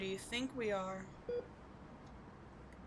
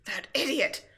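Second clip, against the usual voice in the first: loud contemptuous snap; angry insult; 5 semitones higher, 11 dB louder than usual. Acted. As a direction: spit the insult at the absent hero. Villain cursing the hero.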